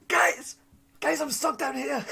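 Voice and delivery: high-pitched, drawn-out yell to companions; calling for help. Acted. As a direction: yell up from below for rescue. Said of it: "you yell up to your party".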